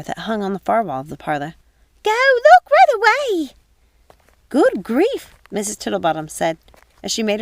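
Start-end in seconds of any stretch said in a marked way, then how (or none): 2.0-3.5 high, loud flustered maid voice; alarmed young housekeeper pleading; high excited servant's voice; Missus Pickle, urgent. Acted. 4.5-5.3 put-on higher, louder old lady huff; a fussy elderly woman grumbling; higher exasperated matron voice; Missus Tittlebottom, exasperated.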